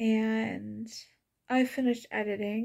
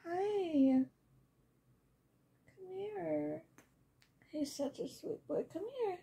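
talking to a pet; 6 semitones higher, 3 dB quieter than usual. Real, not acted. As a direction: coo at the kitty. Talking to the cat.

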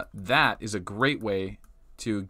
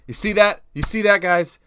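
excited; 8 semitones higher, 7 dB louder than usual. Real, not acted. Showing chat something.

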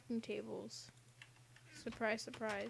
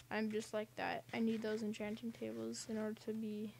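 usual speaking voice

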